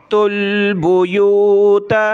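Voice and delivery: तुल with ता and लाम joined, then बुयूता drawn out; joined recitation. Shown as a demonstration. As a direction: join ता and लाम smoothly in तुल. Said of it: "ये ता इस लाम के साथ मिलानी है". (ता को लाम के साथ मिलाकर, फिर बुयूता)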